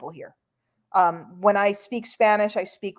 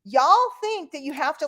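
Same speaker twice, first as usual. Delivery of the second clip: normal talking voice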